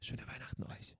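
verspielt geflüsterte Abschiedsgrüße; flüster die Grüße ganz leise ins Mikro; geflüstert. Acted. Whispered goodbyes at episode end. (Moderator verabschiedet sich flüsternd von den Hörern)

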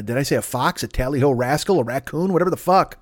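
ordinary talk, nothing marked

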